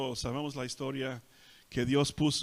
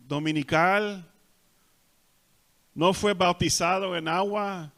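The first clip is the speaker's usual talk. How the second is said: raised, louder preaching cadence; forceful preaching; 5 semitones higher, 5 dB louder than usual. Acted. Preacher lists what the thief never did, forcefully. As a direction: drive it home to the congregation.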